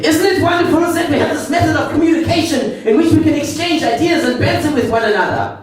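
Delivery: very high, prim mock German clerk; overly polite sarcastic German. Hostel clerk mocking the tourist, high prim German accent. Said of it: "he said".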